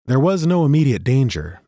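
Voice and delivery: usual speaking voice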